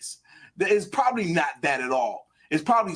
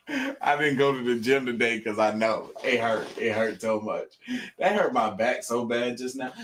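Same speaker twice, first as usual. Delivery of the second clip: ordinary talk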